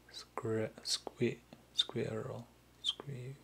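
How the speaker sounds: hushed, halting syllables; sounding out quietly. Real, not acted. Streamer working out squirrel in katakana, whispered to self. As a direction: try the word out under your breath.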